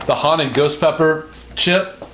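ordinary delivery

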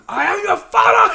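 high, loud mock shout of an old father. Son mimics dad yelling at sister, high mock shout. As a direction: bellow it like an offended dad. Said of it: "She goes".

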